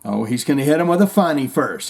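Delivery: ordinary talk, nothing marked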